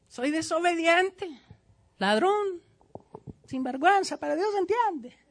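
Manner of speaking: high, airy put-on voice shrugging off sins; mock sinner excuse. Pastor mimics a sinner excusing himself, high carefree shrug. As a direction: play the sinner who waves it all away.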